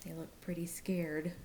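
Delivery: soft unvoiced whisper; whispered aside. Teacher whispering to the class that the animals are scared. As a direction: lean in and whisper to the kids.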